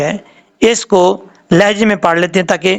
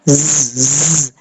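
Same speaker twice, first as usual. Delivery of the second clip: a sustained buzzing zz with a sharp whistle; whistling buzz; 4 semitones lower than usual. Shown as a demonstration. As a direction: hold a hissing zz between the teeth. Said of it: "سیٹی کیسے کہتے ہیں؟".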